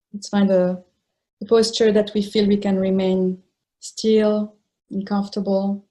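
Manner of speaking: unhurried phrases with long, settling pauses; guided meditation. Real, not acted. Teacher settling meditators into a comfortable posture, unhurried guided meditation. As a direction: guide them into stillness, leaving space between phrases.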